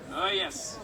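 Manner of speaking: ordinary delivery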